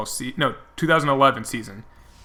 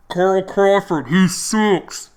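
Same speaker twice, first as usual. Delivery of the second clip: imitating a muffled, raised megaphone heckle; 6 semitones higher, 6 dB louder, much slower than usual. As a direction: call it out high and loud, vowels muffled like through a megaphone. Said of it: "I'm, like, muffled 'cause we're close".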